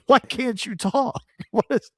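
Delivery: amused, incredulous ribbing; laughing mock exasperation. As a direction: tease them in disbelief through laughter. Teasing Georgian coworker, laughing.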